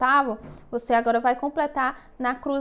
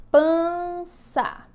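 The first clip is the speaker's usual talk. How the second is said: slow, sustained PANÇA, raised pitch; dictation; 4 semitones higher, 3 dB louder than usual. Shown as a demonstration. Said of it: "eu vou ditar algumas palavrinhas".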